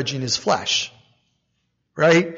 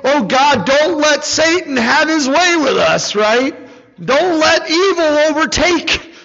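higher, louder pleading to God; mock pleading prayer; 8 semitones higher, 5 dB louder than usual. Preacher imitating a fearful believer's prayer, higher and louder. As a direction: pray it like a worried believer begging. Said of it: "shouldn't we pray".

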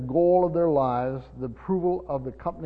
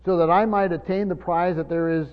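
louder, driving preacher cadence; preaching at full force; 5 dB louder than usual. Real, not acted. Pastor exhorting the church to run the race, full force. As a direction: rouse them to run the race.

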